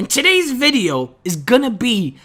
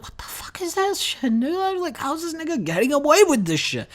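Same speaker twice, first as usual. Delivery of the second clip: high, hushed disbelief; stunned whisper; 7 semitones higher, 6 dB quieter than usual. Acted. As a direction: whisper it aside, scandalized by what you just saw. Stunned kid watching friend yell.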